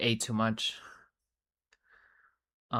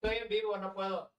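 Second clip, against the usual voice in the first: soft, higher Spanish aside off mic; hushed aside; 7 semitones higher, 10 dB quieter than usual. Real, not acted. En voz baja, hablando fuera del micrófono. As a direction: whisper it away from the mic.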